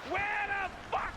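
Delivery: high-pitched, escalating mock rage; hammed-up furious interrogation; angry. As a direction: rant and demand like an enraged boyfriend. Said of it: "when they get angry".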